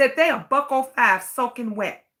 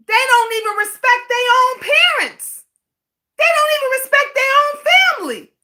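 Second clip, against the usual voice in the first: much higher and louder, crying out in outrage; outraged exclaim; 11 semitones higher, 5 dB louder than usual. Real, not acted. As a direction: pitch it up high and let the indignation ring. Ranting about disrespectful children.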